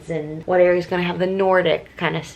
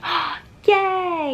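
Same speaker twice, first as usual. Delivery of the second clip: high delighted little cheer; excited squeal; 11 semitones higher than usual. Retold or imitated. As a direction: squeal with happy surprise.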